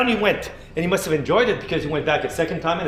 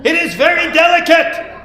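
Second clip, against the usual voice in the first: high, louder, laughing; laughing admission; 11 semitones higher, 8 dB louder than usual. Acted. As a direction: giggle out the confession. Beaver admits sore bum.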